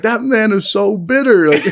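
yelling back at the driver, amused mock outrage. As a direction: shout it back through laughter at the bitter man. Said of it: "I remember yelling back".

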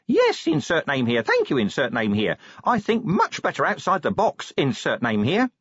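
imp voice. Acted. Imp replies.

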